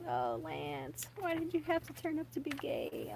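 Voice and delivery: high, soft, wistful pleading; mock lament. Fan to her old boy-band crush, playful mock lament.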